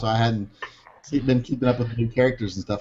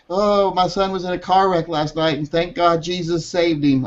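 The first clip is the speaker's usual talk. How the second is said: higher, sweet churchy voice; mocking pious poster; 6 semitones higher than usual. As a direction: parody grateful believer. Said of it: "Someone be like".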